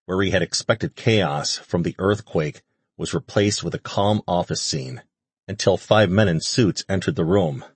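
ordinary delivery, nothing marked

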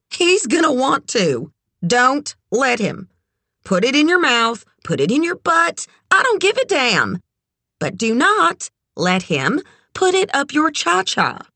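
high, emphatic character voice; brassy, frank friend. A narrator's reading. Red warns Kit about men, brassy and blunt. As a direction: lay it out bluntly, pitched well up.

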